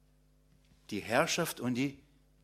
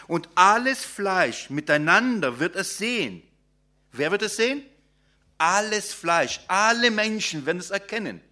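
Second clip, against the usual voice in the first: raised, ringing sermon voice, proclaiming each line; preaching; 3 semitones higher, 4 dB louder than usual. Acted. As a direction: proclaim it to the congregation with rising fervour. Proclaims verse five.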